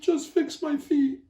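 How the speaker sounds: high, pleading little chipmunk. A narrator's reading. Chipmunk vowing to Gila Monster, high pleading. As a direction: beg in a squeaky critter voice. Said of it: "your chipmunk voice".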